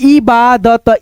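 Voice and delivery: इबादत slowly, part by part, इ-बा-दत; split into parts. Shown as a demonstration. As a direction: sound out इबादत in separate drawn-out pieces. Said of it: "दो भागों में divide करके".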